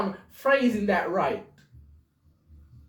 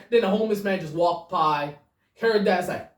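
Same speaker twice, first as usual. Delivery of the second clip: usual speaking voice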